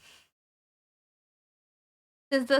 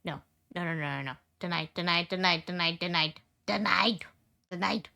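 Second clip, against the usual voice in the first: playful denial; 3 semitones lower, 3 dB quieter than usual. Pretend. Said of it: "I'm in denial".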